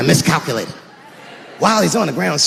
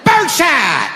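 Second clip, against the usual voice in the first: shouted warning; 8 semitones higher, much slower than usual. Acted. Acted shooting the intruder.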